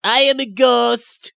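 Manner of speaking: high, loud ghostly warning; haunt them with a slow ghost wail; high eerie spirit voice; spooky ghost. Acted. Ghost announces itself.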